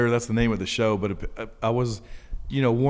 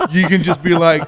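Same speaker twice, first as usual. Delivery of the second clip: excited, laughing; 8 semitones higher, 7 dB louder than usual. Real, not acted. Excited beer-can talk, laughing.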